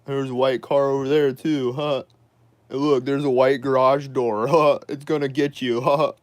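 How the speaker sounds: high, loud taunting voice with laughs; mocking grandma. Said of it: "She's like".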